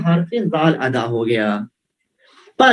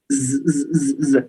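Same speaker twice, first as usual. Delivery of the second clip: buzzing z; 5 semitones lower, much slower than usual. Shown as a demonstration. Teacher demonstrating the buzzing z sound to students. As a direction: hold a buzzing zzz like a bee. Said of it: "اس آواز کو کیا کہیں گے".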